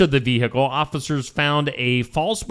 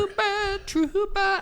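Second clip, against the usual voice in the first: squeaky voice; 4 dB quieter than usual. Pretend. Squeaky repeat of segment word.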